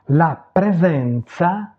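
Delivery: "La presenza" slowly and distinctly in Italian; Italian pronunciation demo. Shown as a demonstration. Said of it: "prononcer les mots". (prononcer « La presenza » lentement, à l'italienne)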